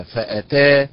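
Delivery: فأتى with the ta pushed; stress on the ta. Shown as a demonstration. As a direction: push the ta of فأتى. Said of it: "النبر فيها على حركة التاء". (نبر على التاء)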